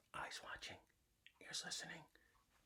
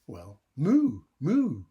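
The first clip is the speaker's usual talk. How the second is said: muttering cow; 10 dB louder, much slower than usual. Acted. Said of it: "who muttered".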